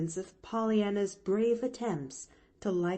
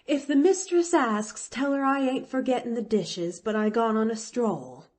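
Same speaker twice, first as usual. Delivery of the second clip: louder, higher parting call; flung over shoulder; 4 semitones higher, 6 dB louder than usual. A narrator's reading. Nancy leaves word for the mistress, called back loudly. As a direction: toss it back as you hurry off. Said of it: "she flung back over her shoulder".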